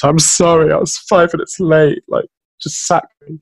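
high-pitched, over-the-top retold apology; mock frantic apology. Employee apologising to manager for being late, mock frantic.

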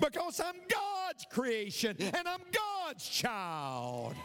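high, slow, sustained proclamation; triumphant preaching cry. Real, not acted. Preacher declaring worth to the congregation, triumphant cry. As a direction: stretch it out high, like a rallying cry.

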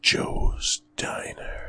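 slow hushed trailer whisper; spooky whisper. Pretend. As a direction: breathe the name out slowly, spooky. Dramatic blurb reveal.

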